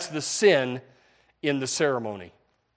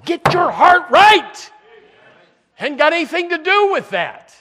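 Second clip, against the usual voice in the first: much higher and louder, pressing the point; fervent raised rebuke; exasperated preaching; 12 semitones higher, 10 dB louder than usual. Real, not acted. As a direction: cry out to get hearts right. Preacher rebuking complainers, pitch shoots up.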